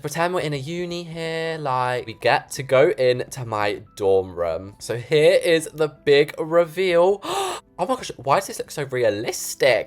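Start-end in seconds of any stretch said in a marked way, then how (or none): 4.8-7.2 loud, slow building announcement; big reveal. Real, not acted. Vlogger announcing dorm room reveal, dramatic. 7.3-7.8 high sharp gasp of amazement; surprised gasp. Real, not acted. Vlogger seeing finished dorm, gasping.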